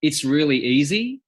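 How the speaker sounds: Australian cowboy accent with a rising end; Australian accent, lilting upward. Shown as a demonstration. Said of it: "the main cowboy spoke like this".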